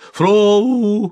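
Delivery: high, loud drawn-out yell; long rising cry out of sleep; sleeper's shout. A narrator's reading. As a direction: holler as if suddenly understanding everything. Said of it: "крикнул спящий".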